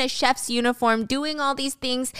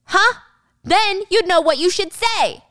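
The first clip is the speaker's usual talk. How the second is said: slow, higher and louder scolding; angry scolding; 8 semitones higher, 5 dB louder than usual. Acted. Wang scolding the flight attendant, slow and loud. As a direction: lecture her harshly.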